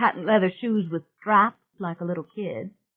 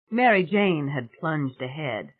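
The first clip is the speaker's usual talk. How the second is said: ordinary delivery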